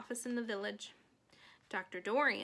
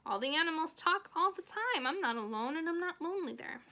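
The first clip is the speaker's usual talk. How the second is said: lightly voiced child insisting, higher pitch; Fern, insistent girl; 6 semitones higher, 3 dB louder than usual. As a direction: protest like a little girl defending her friends. Teacher paraphrases Fern's protest.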